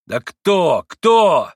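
furious cry; fierce shout. A narrator's reading. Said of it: "почти свирепо вскричал Иван".

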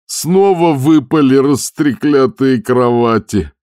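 high, loud, slow wail of disgust; despairing shout. A narrator's reading. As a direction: curl out the curse in despair. Skipper despairing continues. (шкипер громко и протяжно сетует)

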